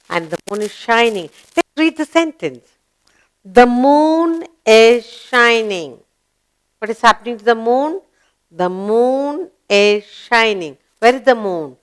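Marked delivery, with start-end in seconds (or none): 3.5-6.0 slow, stretched-out sentence reading; slow model reading. Shown as a demonstration. Teacher reading the moon sentence to pupils, slow model reading. 8.5-10.8 slow, stretched-out sentence reading; slow model reading. Shown as a demonstration. Teacher reading the moon sentence to pupils, slow model reading.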